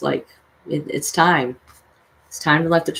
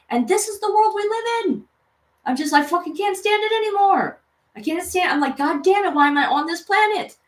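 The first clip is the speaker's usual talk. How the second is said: much higher, strained cry of despair; furious outburst; 11 semitones higher than usual. Real, not acted. Venting to viewers at the end of a rant, desperate fury. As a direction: break out in raw exasperation. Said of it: "Excuse my rant".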